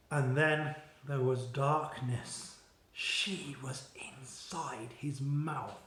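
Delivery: plain reading voice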